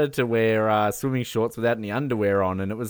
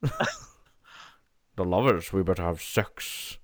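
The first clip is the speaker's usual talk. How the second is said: very slow, slurred Scottish impression; softened low voice with slushy s sounds; Sean Connery; 5 semitones lower, 5 dB quieter, much slower than usual. Bond propositions a woman over the lovers card, Sean Connery impression. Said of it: "Sean Connery would be saying something like that".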